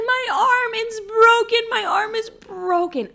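very high, loud hammed-up wail; fake wailing. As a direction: fake the pain, wailing for sympathy. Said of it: "claiming that my arm is broken".

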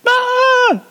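high shrieking whale call; shrill whale cry. Acted. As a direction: let out a piercing whale squeal. Shrill whale noise attempt.

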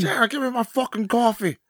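high-pitched rock star impression. Husband demanding coffee from [name], high put-on rocker impression. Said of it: "I feel like [name]".

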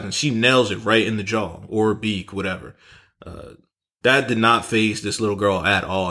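usual speaking voice

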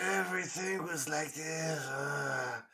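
mock an actor's strange hushed delivery; imitating whispery actor voice. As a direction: put on an exaggerated under-the-breath character voice. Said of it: "What the hell was he doing with his voice".

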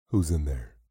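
firm demanding call into the pantry. A narrator's reading. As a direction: ask it like an order. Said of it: "demanded David Gleason".

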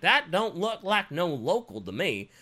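hammed-up southern local; southern accent. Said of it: "Why are they so southern?".

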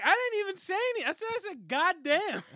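high, fast, disbelieving squeal; indignant protest. Retold or imitated. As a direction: protest your innocence in a rush.

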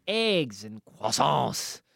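a slow, light mock French accent on "eggs and croissants". Shown as a demonstration. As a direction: drawl out "croissants" the French way, half-joking. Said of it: "that was my French accent".

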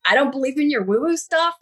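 imitating the friend who thinks it's all nonsense; sceptical friend. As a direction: brush it off as a skeptic would. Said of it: "And she's like".

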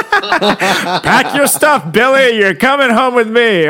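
high, slow, laughing fake-friendly voice; hammed-up high adopter voice; mock cheerful adopter. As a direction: play the sleazy cheerful parent.

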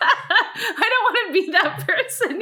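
high, slowed by real laughter; laughing. Real, not acted. Woman embarrassed by her own outburst, laughing. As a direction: get it out through embarrassed giggles.

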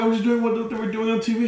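high-pitched flustered excuse, imitated; mock panicked kid. Kid caught by his mom, mock high-pitched panic.

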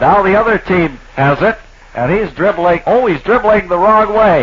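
hammed-up sportscaster excitement; excited play-by-play. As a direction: call the wrong-way dribble with mock alarm. Mock play-by-play, rising excitement.

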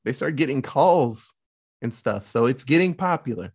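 ordinary talk, nothing marked

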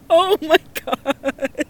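slow, giggly oh my God; drawn-out amused gasp; laughing. Real, not acted. Reacting to funny submission.

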